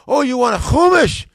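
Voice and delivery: high, loud dawning realisation; New York saleslady. Retold or imitated. Bookseller lady working out the order, loud realisation. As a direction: suddenly catch on as the saleslady. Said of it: "she said".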